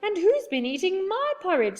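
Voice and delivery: high indignant mother bear. A narrator's reading. Mother bear echoes father bear.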